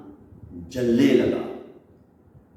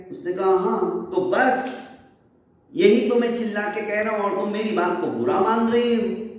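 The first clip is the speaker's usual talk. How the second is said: higher, fast, pleading voice; urgent insistence; 5 semitones higher than usual. Acted. Dagdu warns the chaudharani her house will burn, higher and fast. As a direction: press your warning fast and high, desperate to be believed.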